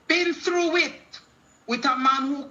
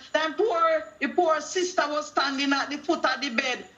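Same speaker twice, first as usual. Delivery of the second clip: usual speaking voice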